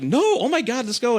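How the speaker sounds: a date squealing that it feels like prom; imitating his date. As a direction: play her up as starstruck. Mimicking date's excited reply.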